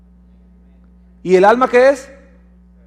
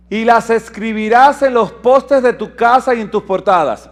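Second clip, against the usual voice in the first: higher, louder ringing reading; proclaiming scripture; 4 semitones higher, 5 dB louder than usual. Acted. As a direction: lift the verse up to the hall. Reads scripture verse.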